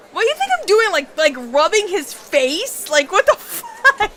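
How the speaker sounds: high, loud, disbelieving what the fuck; incredulous outburst. Acted. Reading the visual novel aloud, yelling back at the friend in disbelief. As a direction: squeal in disbelief at a ridiculous question. Friend outraged by weird question.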